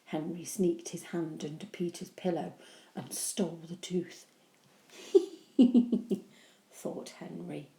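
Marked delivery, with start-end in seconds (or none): 5.6-6.2 sneaky laugh. Acted.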